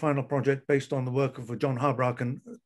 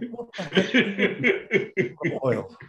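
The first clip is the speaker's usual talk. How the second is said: laughing; 6 semitones higher, slower than usual. Real, not acted. Laughing at the absurd advice.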